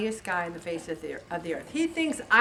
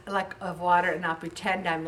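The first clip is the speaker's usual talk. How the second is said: ordinary delivery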